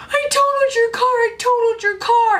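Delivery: high, frantic retelling of the panicked call; panicked phone confession. Retold or imitated. Calling brother after the crash, high and panicked. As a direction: replay the moment of blurting it out in panic. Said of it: "and I was freaking out".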